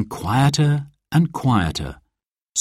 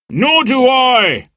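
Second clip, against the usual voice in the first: high, slow indignant call; drawn-out high protest; heckling agreement; 13 semitones higher, 4 dB louder, slower than usual. A narrator's reading. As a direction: back up the heckler loudly from the crowd. Man joins the heckling.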